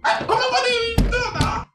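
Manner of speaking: high loud fast panicked yell; panicked shout. Acted. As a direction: yell in shock and embarrassment.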